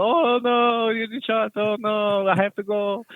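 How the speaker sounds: high, whiny imitation of a frightened local; mock scared local. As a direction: squeal it like a coward running off. Mocking locals describing US Army.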